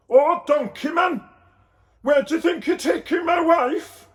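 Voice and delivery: very high, loud shout. A narrator's reading. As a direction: yell after them in outrage. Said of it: "Joseph shouted".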